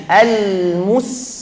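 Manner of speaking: Al-mus with lips pushed forward too long; wrong rounded mus. Shown as a demonstration. Teacher showing the wrong pouted mus. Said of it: "Gak boleh lanjut monyongnya".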